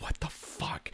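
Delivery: hushed stunned whisper. Retold or imitated. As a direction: breathe it so nobody hears. Aside while on the phone.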